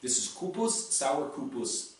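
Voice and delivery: ordinary delivery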